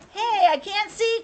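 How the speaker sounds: high, loud complaint; crying out. A narrator's reading. Wilson under his sheet to Francine, crying out. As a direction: call it out high and urgent. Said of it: "cried Wilson".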